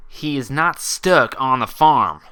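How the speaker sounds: loud, slow angry snap; sudden loud defensive snap; snapped. Acted. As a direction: bite each word out at her. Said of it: "Applejack snapped".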